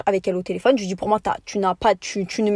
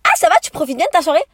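a touch of snide mock-sweetness, a bit quicker; mocking fake friendliness; 6 semitones higher, 4 dB louder, faster than usual. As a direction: imitate the friend's faux-friendly dig. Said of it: "elle me repose la question en mode". (imitation moqueuse et faussement aimable)

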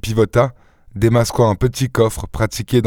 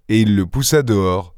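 plain reading voice

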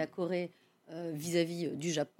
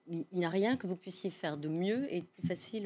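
ordinary talk, nothing marked

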